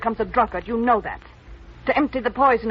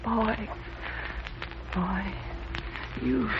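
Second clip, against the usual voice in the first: put-on softer, pleading call of "boy"; 11 dB quieter, much slower than usual. Woman softly calling her young lover back. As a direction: call him back gently, almost under the breath.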